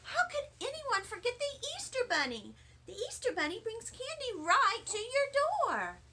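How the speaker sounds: put-on high, grumpy little-girl voice; a high, huffy little girl; grouchy Lucille. A narrator's reading. Lucille's voice continues.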